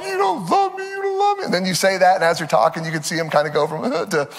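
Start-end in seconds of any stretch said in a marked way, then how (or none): 0.0-1.4 put-on high, whining kid voice; mock child whine, high pitched; whiny child. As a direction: mimic a sulking child.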